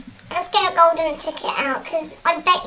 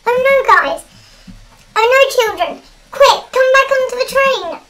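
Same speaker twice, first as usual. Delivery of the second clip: alarmed; 5 semitones higher, 6 dB louder than usual. Real, not acted. Urgent alarm at evil Santa.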